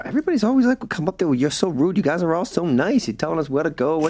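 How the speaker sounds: high-pitched, incredulous tourist quote; mock out-of-towner, pitched well up; imitating visitors. Acting out surprised visitors' complaints.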